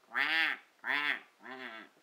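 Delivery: low, loud playful quacking; duck quack. Pretend. Parent quacks loudly for the baby's duck page. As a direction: quack like a big silly duck.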